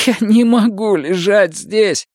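put-on high, frantic young man pleading; desperate youth begging for help; Mikael panicking.

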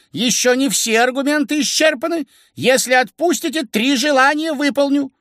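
very high desperate fish voice; piercing plea. A narrator's reading. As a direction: plead at the top of your range. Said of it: "крикнула она пронзительно". (пронзительная мольба рыбки)

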